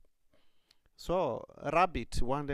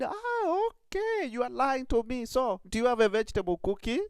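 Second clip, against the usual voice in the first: much higher, louder, quick; triumphant rabbit; 12 semitones higher, 6 dB louder than usual. Acted. Rabbit catches the rat lying, high gleeful voice.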